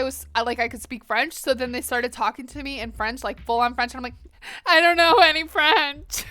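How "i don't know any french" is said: high, loud helpless protest; hammed-up comic alarm at being found out; mock panic. Retelling being spoken to in French, mock panic.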